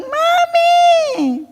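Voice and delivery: put-on high, wailing child's cry of "Mommy!"; crying child calling. Imitating a crying child calling for his mom, high wail. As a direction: wail it like a hurt kid.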